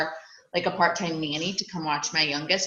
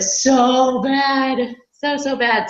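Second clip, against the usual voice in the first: drawn-out yearning, higher and louder; so bad; 7 semitones higher, 6 dB louder, much slower than usual. Real, not acted. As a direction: stretch it out, aching for it. Longing for lake house goal.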